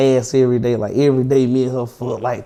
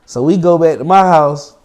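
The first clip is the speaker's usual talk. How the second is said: usual speaking voice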